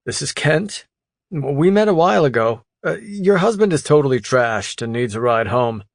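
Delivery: easygoing buddy breaking news; friend's voice. Acted. Kent voiced calling the wife.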